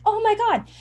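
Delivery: high, amazed squeal of a viewer; mock shocked gasp. Viewers seeing the price, voiced as a high shocked gasp.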